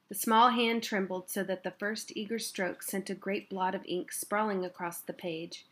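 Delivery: ordinary delivery, nothing marked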